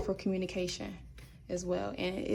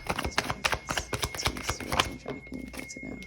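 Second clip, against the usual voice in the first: muttering to herself; 4 dB quieter, slower than usual. Real, not acted. Mumbling card meaning to herself.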